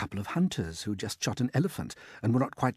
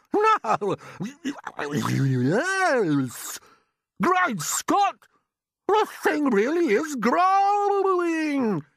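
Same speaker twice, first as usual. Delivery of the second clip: a much higher, louder put-on aunt's voice, excited; Aunt Sponge; 13 semitones higher, 7 dB louder, much slower than usual. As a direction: play the greedy aunt gaping at the peach.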